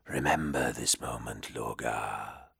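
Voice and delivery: low, slow, whispery demon voice; a cold ancient demon murmuring low. Acted. Demon's parting counsel.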